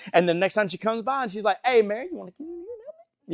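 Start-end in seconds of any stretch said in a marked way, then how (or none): 1.7-3.3 passive-aggressive, very high-pitched summons; hammed-up sugary nagging; Martha. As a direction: needle a sibling in a put-on high voice. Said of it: "just kinda passive aggressive".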